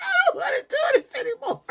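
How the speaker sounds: very high, quick wail through laughter; mock crying. Laboring mom telling nurse she quits, laughing mock crying. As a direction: bawl it playfully, dissolving into giggles. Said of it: "I was crying".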